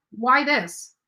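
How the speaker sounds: ordinary talk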